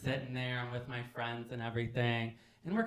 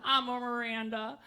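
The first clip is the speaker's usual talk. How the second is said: much higher, drawn-out delivery; high acted declaration; 10 semitones higher, slower than usual. Friends match themselves to Sex and the City characters, high declaration.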